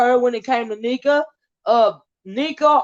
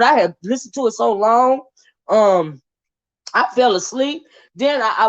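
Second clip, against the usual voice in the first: ordinary talk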